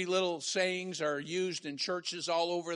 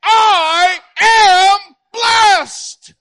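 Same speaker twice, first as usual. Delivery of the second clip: very slow, high, loud shout; full shout; 12 semitones higher, 20 dB louder, much slower than usual. Shown as a demonstration. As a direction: give it all you have. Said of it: "Say it again".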